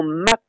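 "mat" with a short, quick A. Shown as a demonstration. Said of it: "avec un A bref".

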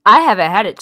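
loud, emphatic repeat. Real, not acted. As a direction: say it up loud for someone who missed it. Called on by name, repeats answer louder.

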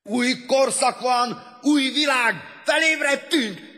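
slow, high, loud proclamation; proclaiming shout. A narrator's reading. Newly awakened man proclaiming a new era, shouting. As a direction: shout it out word by word. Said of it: "kiáltotta".

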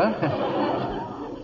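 breathy, soundless sly chuckle. Acted. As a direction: chuckle to yourself over the trick. Sly chuckle after a lie.

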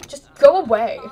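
loud, high shout to go away; angry homeowner yelling at an intruder; yelled dismissal. Pretend. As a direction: shoo the mermaid off at full voice. Voicing a Sim shooing mermaid.

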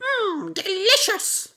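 very high savouring hum; chicken relishing. A narrator's reading. Chicken savouring worm before frog, very high. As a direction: hen tasting a worm with delight. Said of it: "and said".

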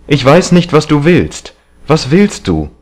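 high, exasperated demand; exclaimed protest. A narrator's reading. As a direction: cry it out, pressing for an answer. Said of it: "rief er aus". (erregt und hoch gerufen)

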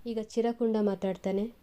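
ordinary delivery, nothing marked

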